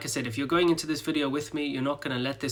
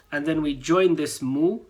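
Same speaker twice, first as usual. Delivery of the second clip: normal talking voice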